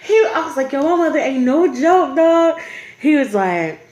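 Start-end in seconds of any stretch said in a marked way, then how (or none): 0.7-2.6 emphatic warning. Retold or imitated. Retold warning about her mother.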